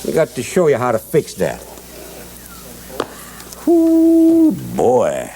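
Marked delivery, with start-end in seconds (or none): 3.7-4.5 long, high, loud "whoo"; drawn-out whoop of exclamation; exclaiming whoop. Real, not acted. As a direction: let out one sustained high whoop. Exclaims while fetching the goose.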